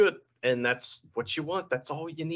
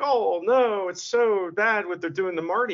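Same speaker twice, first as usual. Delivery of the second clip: Kevin Kelly's sarcastic concern; 7 semitones higher than usual. Retold or imitated. Host mocking a commentator's fake concern, sarcastic. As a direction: fake caring, voice raised in mock sympathy. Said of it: "he was all sarcastic".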